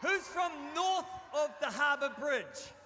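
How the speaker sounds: loud, high call out to the crowd; rally the room from the stage; big hype shout to the campers; crowd callout. Real, not acted. Hyping up camp crowd.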